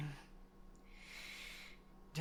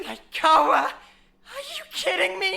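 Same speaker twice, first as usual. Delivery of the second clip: high, slow, half-laughing cry; exasperated disbelief; 8 semitones higher, 4 dB louder, slower than usual. Acted. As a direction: nearly shriek it in disbelief. Shocked at the brand sacrifice.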